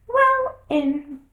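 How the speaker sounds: high, small dormouse voice; a tiny drowsy creature telling a tale; sleepy little dormouse. A narrator's reading. Dormouse voice held.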